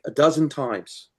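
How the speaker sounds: ordinary talk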